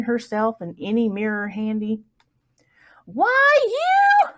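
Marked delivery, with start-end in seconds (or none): 3.1-4.3 high, loud outraged shriek. A narrator's reading. Prissy mayor's wife shrieking at Plug. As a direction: shriek out in shock. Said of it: "she shrieked".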